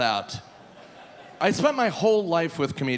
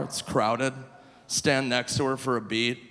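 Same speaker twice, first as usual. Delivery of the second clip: ordinary talk, nothing marked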